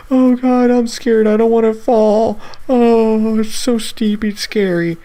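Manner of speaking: high, loud, squeaky frightened whine; whiny scared kid. Retold or imitated. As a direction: play your scared thirteen-year-old self on the steep hike. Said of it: "I was a whiny thirteen-year-old back then".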